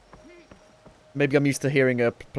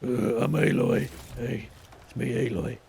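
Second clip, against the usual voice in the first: Aloy. Retold or imitated. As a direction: lightly imitate a deeper-voiced heroine. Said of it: "It sounds way deeper".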